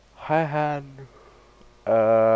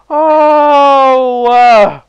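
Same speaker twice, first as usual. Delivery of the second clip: high loud startled oh; loud high gasp of shock; shocked cry; 14 semitones higher, 16 dB louder than usual. Real, not acted. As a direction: yelp in real surprise. Shocked cry at game event.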